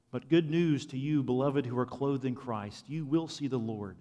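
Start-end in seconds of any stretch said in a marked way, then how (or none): none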